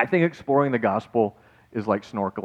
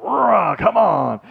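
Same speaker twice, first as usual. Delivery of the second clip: loud, high mock preacher roar; hammed-up fire-and-brimstone outburst; gospel preacher impersonation; 10 semitones higher, 7 dB louder, much slower than usual. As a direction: holler like a revival preacher. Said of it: "these gospel preacher guys are like".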